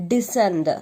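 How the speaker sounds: descend said with a silent c, dee-SEND; model pronunciation. Shown as a demonstration. Teacher modelling a word for pupils to copy, clearly. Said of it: "the pronunciation of this word is".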